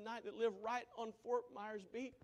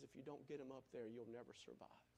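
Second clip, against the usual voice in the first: softer, lower grave warning; grim hush; 7 semitones lower, 13 dB quieter than usual. Retold or imitated. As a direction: deliver the bad news gently. Anchor's grim reply.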